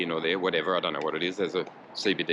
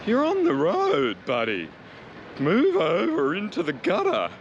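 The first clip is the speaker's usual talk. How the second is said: mocking a road-rage driver in a much higher put-on voice; mock angry motorist; 11 semitones higher, 4 dB louder than usual. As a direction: ham up the shouty driver, slow and loud on "on the road". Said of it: "a general motorist telling me".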